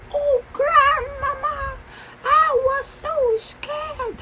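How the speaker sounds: very high, hammed-up girlish wail; mock sobbing. A narrator's reading. Red tells grandmama she was scared, hammed-up high sobbing. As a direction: wail it like a tiny storybook girl in tears.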